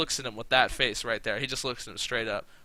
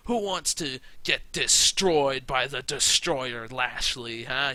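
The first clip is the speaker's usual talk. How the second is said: slow, louder mocking challenge; drawn-out heel taunt; 5 dB louder, slower than usual. Champion taunting challengers, slow louder mocking taunt. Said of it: "But he's like".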